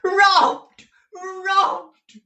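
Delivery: very high, loud anguished scream; shrieking old miser in panic. A narrator's reading. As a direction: scream it out as an old man just robbed. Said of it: "screamed Arthur Gride".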